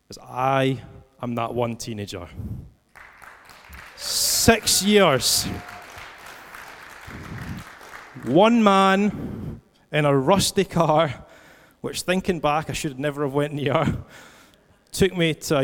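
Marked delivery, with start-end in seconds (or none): 4.0-11.2 "Six years" and "One man" high, loud and drawn out; emphatic climax. Real, not acted. Preacher revealing he was that teenager, "Six years", "One man" proclaimed emphatically. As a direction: land "Six years" and "One man" forcefully, with long pauses. Climax of personal testimony, repeated phrase.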